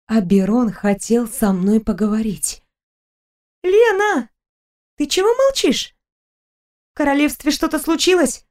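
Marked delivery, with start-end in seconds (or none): 3.6-8.5 put-on higher, lively young woman; Stella's voice. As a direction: chatter happily about a trip. Stella worried.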